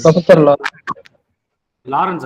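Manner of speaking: calling out the name loudly to the class. Real, not acted. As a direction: call the name out to the room. Teacher calls name back to class.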